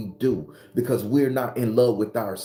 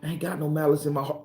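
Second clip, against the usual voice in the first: fast and higher, brushing it off; quick rushed repeat; 5 semitones higher, faster than usual. Real, not acted. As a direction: rattle it off quickly. Repeats claim, rushed and raised.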